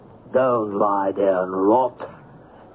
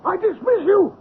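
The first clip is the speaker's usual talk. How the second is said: much higher, frantic yell at the assistant; shrieking fury. Acted. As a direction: scream him down in panic and rage. Chief screams at his assistant.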